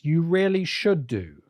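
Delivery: usual speaking voice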